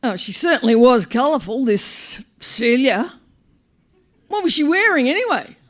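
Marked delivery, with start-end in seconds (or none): none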